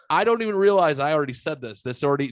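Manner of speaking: ordinary talk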